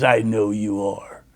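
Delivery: low, slow, lightly voiced threat; a hint of the biker's growl; deep growling voice. Biker singling out a boy, low and menacing. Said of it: "He had this deep growling voice".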